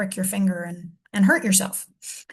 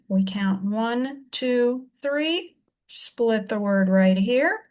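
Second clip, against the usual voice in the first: slow count; much slower than usual. Shown as a demonstration. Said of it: "teacher demonstrates counting".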